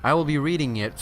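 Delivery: ordinary talk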